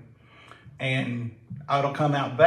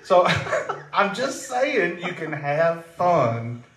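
laughing; 5 semitones higher, slower than usual. Real, not acted. Laughing after joke.

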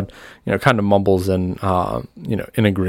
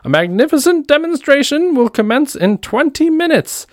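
high, loud showman's proclamation; crowd announcement; 4 dB louder than usual. Acted. Game master as salesman announcing to the crowd, high ringing announcement. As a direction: proclaim it like a medicine-show huckster. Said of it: "he announces to the crowd".

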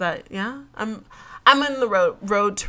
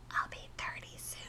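breathy confessional whisper; 19 dB quieter than usual. Real, not acted. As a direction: whisper it like a little secret. Confessing age, wanting kids.

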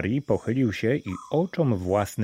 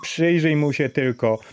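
mocking laughter; 7 semitones higher than usual. A narrator's reading. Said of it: "zaśmiewali się ludzie".

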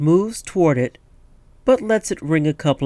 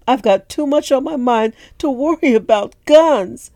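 higher, louder woman character voice; older woman voice; 11 semitones higher, 7 dB louder than usual. Acted. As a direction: voice the weary barmaid, pitched up. Cleo voiced in a story.

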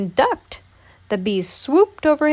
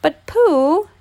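high, loud, pleading little voice; protesting; 8 semitones higher, 7 dB louder than usual. A narrator's reading. Piglet objects to Pooh's plan, protesting. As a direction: protest urgently, voice leaping up. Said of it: "began to protest".

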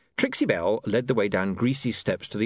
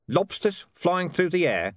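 flat; 8 semitones higher than usual. A narrator's reading. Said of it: "said Vimes flatly".

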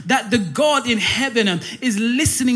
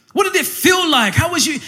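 high, excited mock interview; imagined starstruck questioning; eager questioning; 5 semitones higher than usual. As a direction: pitch it up, burning to know. Acted eager question to Peter in heaven.

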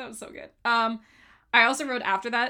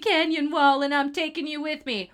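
louder, a bit higher cocky quote; imitating Kuzco; 5 semitones higher, 6 dB louder, slower than usual. Fan quoting Kuzco's boast to a friend, cocky. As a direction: strut through the line like Kuzco. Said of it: "and he goes".

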